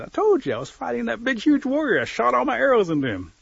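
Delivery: loud, high-pitched insistent boast; exasperated turtle. Acted. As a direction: shout it back as a fed-up turtle.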